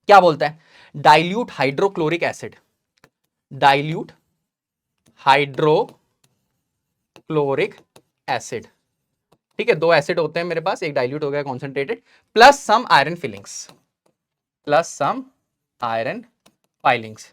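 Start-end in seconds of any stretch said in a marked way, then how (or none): 3.5-8.7 dilute, hydrochloric, acid spaced out with long pauses; word-by-word dictation. Real, not acted. Dictates word by word while writing. 14.7-17.2 plus some, iron, filings, with a pause after each; word-by-word dictation. Real, not acted. Dictates word by word while writing.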